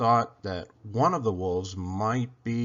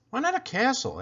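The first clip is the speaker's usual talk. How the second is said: higher, lightly voiced little girl; a curious little girl, pitched up; girl's voice; 12 semitones higher than usual. A narrator's reading. Isabel finds something inside the box, higher girl voice.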